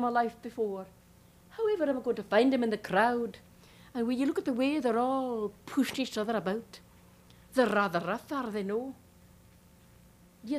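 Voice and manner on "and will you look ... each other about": ordinary delivery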